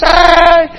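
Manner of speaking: sudden high yelp of fright; mock scream. Preacher imitates terrified disciples, high yelp. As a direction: cry out like a scared fisherman.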